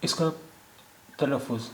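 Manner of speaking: normal talking voice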